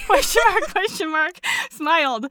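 normal talking voice

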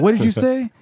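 sharp high demand, voice jumps up; mock angry teacher. Teacher snapping back at the student who insulted him. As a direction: bark the question like an outraged teacher. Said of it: "He's like".

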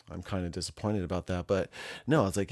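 ordinary delivery

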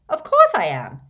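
loud, eager reply; eager acceptance. Acted. Boy accepting his place at Hogwarts, eager. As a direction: blurt out yes.

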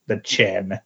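ordinary talk, nothing marked

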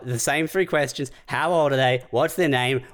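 ordinary talk, nothing marked

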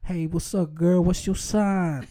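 smooth flirty voice, hammed up; cheesy pickup line. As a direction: lay the charm on thick, mock suave. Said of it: "I'd be like".

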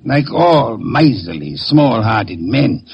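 slow, cutting disdain; slow scorn. Acted. Detective sneering at the killer, very slow. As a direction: drag out each word with contempt.

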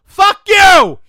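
very loud, high-pitched acted shout; excited outburst. Clerk's imagined answer to the customer, furious mock shout. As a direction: explode at full volume, acting out the fury. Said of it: "how excited were you?".

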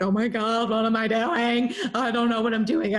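half-mocking her own nerves; nervous panic. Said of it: "I was so nervous".

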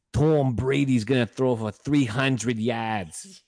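slow, loud, exaggerated Boston accent; hammed-up Boston coworker accent. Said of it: "the worst Boston accent I've ever heard".

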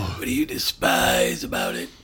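low, breathy growled weird voice. Pretend. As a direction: rasp the question out low in a strange voice. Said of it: "That was the weirdest voice I've ever done".

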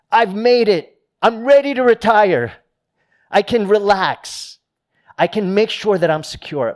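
a hammed-up proud retiree celebrating retirement. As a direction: crow about having made it. Homeowner boasting at retirement.

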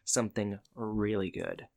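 low, soft mock flirtation; lightly acted come-on, hushed and low; suggestive. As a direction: drop your voice and hint coyly. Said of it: "being kinda suggestive with him".

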